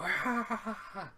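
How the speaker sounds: high mock wail of pain, half laughing; laughing mock demon wail. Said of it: "hear this demon wail in pain".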